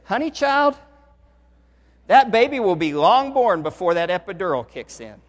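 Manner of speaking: put-on high, loud sassy "Honey child" then down; nurse's voice. Nurse to labouring wife, sassy and loud.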